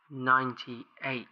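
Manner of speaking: very slow, soft, flat count; creepy emotionless countdown; eerie monotone. A narrator's reading. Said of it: "spoke in an odd monotone".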